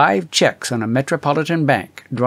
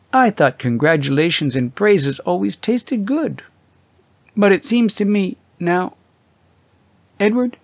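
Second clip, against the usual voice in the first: lightly higher wife's voice, questioning; a hint of an anxious wife; Mary, gently uneasy; 7 semitones higher than usual. A narrator's reading. Mary's voice in the story.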